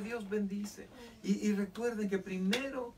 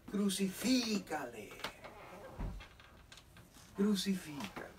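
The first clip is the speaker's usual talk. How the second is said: retold crowd cry; 2 semitones higher than usual. Crowd demanding the death of Jesus, imitated drawn-out cry. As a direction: stretch it out like a distant mob's demand. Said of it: "Y gritaron".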